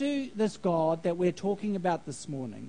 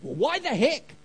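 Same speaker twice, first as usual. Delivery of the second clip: higher-pitched exasperated outburst, acted; mock frustration; 4 semitones higher than usual. Man answering God in prayer, in acted frustration. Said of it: "in somewhat frustration".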